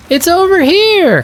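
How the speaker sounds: high cub voice calling out; a small cub shouting that he found the boat; calling little cub. Acted. Pip calls to his daddy that the boat is here, high and bright.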